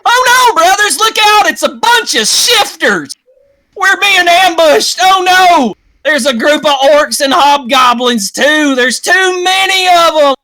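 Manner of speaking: high, loud alarmed shouting; terrified soldier yelling; panicked cry. Acted. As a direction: scream a warning of the ambush. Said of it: "someone cry out".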